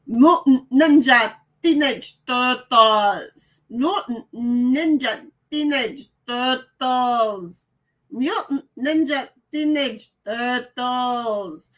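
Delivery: slow, drawn-out mock Russian accent. Streamer reading a chat message aloud in a hammed-up Russian accent. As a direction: ham up a Russian accent, dragging every word. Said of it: "That was my best Russian".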